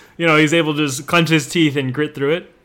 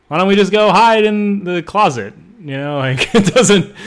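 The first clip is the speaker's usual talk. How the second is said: ordinary talk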